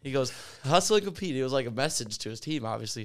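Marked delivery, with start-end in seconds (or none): 0.6-1.3 higher, louder rallying motto; motto punchline. Retold or imitated. Coach's message to his team, higher and louder. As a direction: hammer the motto home.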